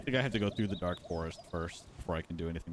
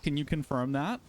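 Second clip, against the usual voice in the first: ordinary delivery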